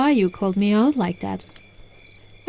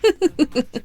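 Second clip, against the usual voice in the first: short chuckle in disbelief; little scoffing laugh; amused laugh; 6 semitones higher than usual. Acted. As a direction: laugh off the doubter. Scoffing at a doubter of magic.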